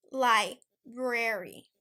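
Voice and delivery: "library" very slow, lie-brer-ee with every r; correct pronunciation. Shown as a demonstration. Teacher repeating to viewers, slow correct library. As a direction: stretch "library" out, each syllable clear. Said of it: "It is".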